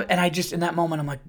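normal talking voice

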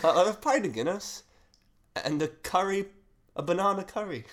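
loud, high Irish joke voice; Irish accent. Acted. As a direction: belt out the Irish guy's order. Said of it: "I was meant to do the Irish guy".

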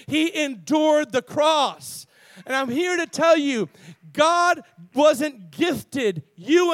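loud, high, drawn-out sermon delivery; full-force preaching. Real, not acted. Preacher telling the congregation they were bought, full-force preaching. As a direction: preach it loud, stretching the big words.

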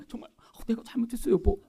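hushed, halting mock apology; hesitant stagey apology, barely voiced; acted heartfelt apology. Shown as a demonstration. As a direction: act out a soft, contrite sorry. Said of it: "그러니까 표정도 더".

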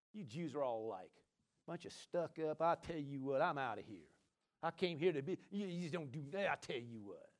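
a hint of a huffy offended mother, low and soft. Preacher voicing the mother who could have been offended, mild huffy grumble. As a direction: grumble it like a hurt, indignant woman. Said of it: "Mama could have said".

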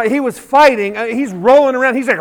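normal talking voice

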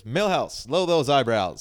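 lightly voiced stern principal; Principal Skinner voice. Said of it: "he goes".

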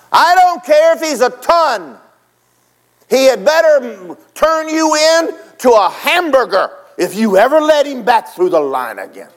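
yelling coach. Retold or imitated. Coach threatens the lineman, loud and high. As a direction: pound the threat home.

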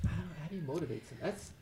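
ordinary talk

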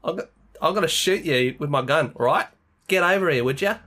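loud Aussie threat with matey lilt; mock tough Australian. Action hero threatens villain with gun, hammed-up Australian. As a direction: act hard, but very Australian. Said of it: "he's trying to be hard".

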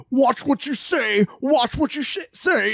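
high, drawn-out imitation of a warning friend; mock warning. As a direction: stretch it out high, mimicking a scolding friend.

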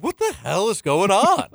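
high-pitched, put-on exasperation; hammed-up high disbelief; mock bewilderment. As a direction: imitate a baffled driver, amused. Jokingly voices the honked-at driver.

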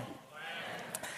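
breathy, voiceless echo of the word Plan; whispered echo. Shown as a demonstration. Preacher models the word Plan for the congregation, whispered. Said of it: "Say plan".

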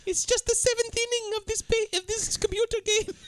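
high-pitched accented character impression; mock a lilting foreign accent up high; Kira. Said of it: "He never likes our Kira impression".

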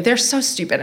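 ordinary delivery

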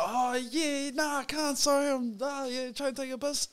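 mock-sweet decline, voice lifted; polite brush-off. Turning down a drunk fan's photo, lightly polite. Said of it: "I'm like".

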